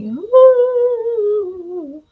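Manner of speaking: long, high mock wail; loud exaggerated boo-hoo on one held note; imitated crying. Imitating everybody at the graduation, mock crying. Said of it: "everybody's crying".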